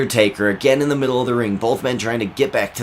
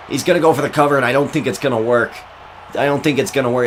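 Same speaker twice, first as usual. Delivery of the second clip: raised-pitch wrestling call; excited play-by-play; 4 semitones higher than usual. Acted. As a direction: build excitement as the finisher looms. Live play-by-play.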